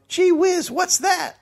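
put-on high gee-whiz kid voice; wholesome 1950s kid. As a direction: chirp it like a wide-eyed boy. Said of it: "boy stood in the road and yelled".

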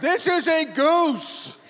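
high, slow, drawn-out goofy voice. Acted. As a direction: stretch it out high and silly. S1 acts the one who opens it.

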